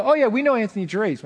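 mock cocky trespassers. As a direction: bluff like you know the owner. Trespassers name the owner, retold.

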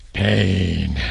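very low, drawn-out growl on "pain."; deep menacing boxer delivery on "pain."; Clubber Lang voice. Retold or imitated. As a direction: punch the word down low like Mister T. Echoing the Clubber Lang line.

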